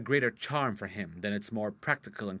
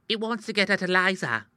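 put-on confidential page boy; 8 semitones higher, 4 dB louder than usual. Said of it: "in a confidential whisper".

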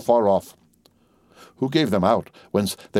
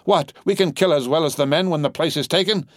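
high, indignant rallying cry; 7 semitones higher than usual. A narrator's reading. Madame urging women to fight like men, crying out. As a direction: cry it out high to stir them up. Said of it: "cried Madame".

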